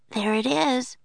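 put-on high, eager girl's voice; girl pointing something out; young girl voice. A narrator's reading. Annie spots treehouse.

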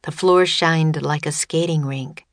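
ordinary delivery

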